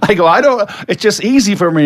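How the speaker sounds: high, laughing mock plea to be left alone; laughing exasperation. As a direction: laugh through a playful groan of defeat. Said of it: "I'm going".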